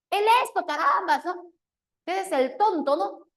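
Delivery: exasperated mom scolding her son, high and loud; annoyed mother. Retold or imitated.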